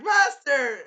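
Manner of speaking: high, very loud wail of protest; crying-out Philo. Acted. Philo crying out to her master, loud high protest. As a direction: cry out high at full volume. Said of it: "And she's like".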